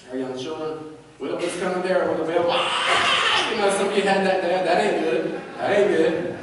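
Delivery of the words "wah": very high, loud mock wail; a cartoonish fake bawl; comic high yelp of a wail. Mocking a loud spanking cry.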